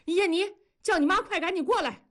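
high, fast alarmed call; startled cry. A narrator's reading. Husband calling for the wife in alarm, startled and fast. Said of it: "传来惊呼".

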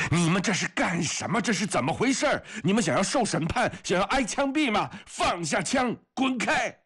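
very high-pitched, urgent yelling; furious roaring. A narrator's reading. Comrade shoves apart two fighting soldiers, roaring. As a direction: roar at them to break it up. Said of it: "夏氏吼叫着把格里高利推开".

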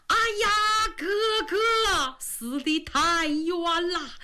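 slow funeral-style wail; mourning wail for his slain brother, slow and drawn; crying lament. Acted. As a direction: drag it out like a mourner's dirge. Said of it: "一边跑一边哭一边喊".

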